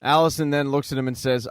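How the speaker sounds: usual speaking voice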